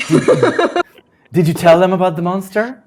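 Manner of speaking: high, loud retort; retorting half-elf. Acted. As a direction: snap back at the dwarf. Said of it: "retorts the half-elf".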